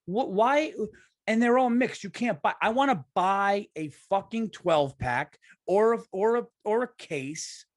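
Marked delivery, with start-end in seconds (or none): 0.1-4.5 exasperated rant. Real, not acted. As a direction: vent it, voice up and pushing. Ranting about flavored seltzers.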